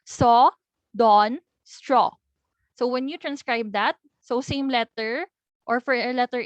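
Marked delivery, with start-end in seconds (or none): 0.0-2.1 Saw, dawn, straw said louder with round open aw; open o demo. Shown as a demonstration. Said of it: "when you produce the sound".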